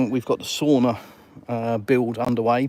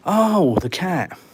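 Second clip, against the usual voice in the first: high, louder "oh" falling away; retold relief; 7 semitones higher, 4 dB louder, much slower than usual. Husband retelling news about wife's cat, mock relieved. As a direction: re-enact a mild wave of relief. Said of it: "I kind of was relieved".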